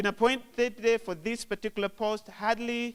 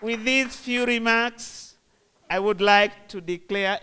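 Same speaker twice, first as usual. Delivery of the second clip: formal declaration; 5 semitones higher, 6 dB louder than usual. Real, not acted. Formal opening declaration at podium.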